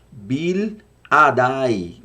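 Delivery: "Bil Adai" stretched out very slowly; slow, careful Hebrew "Bil Adai". Shown as a demonstration. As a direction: draw out each syllable of "Bil Adai". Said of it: "Bil, no hebraico".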